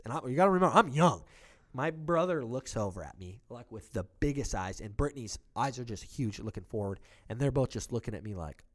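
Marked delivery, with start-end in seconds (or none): none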